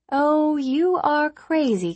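slow, high, drawn-out emphasis; emphatic teasing. A narrator's reading. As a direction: lean hard on you are crazy. Ani answers teasing, emphatic.

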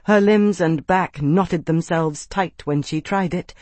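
ordinary delivery, nothing marked

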